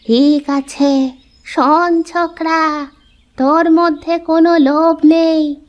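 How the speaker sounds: put-on mystical old woman. Old woman tells the boy not to fear, mystical. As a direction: soothe him like a magic granny.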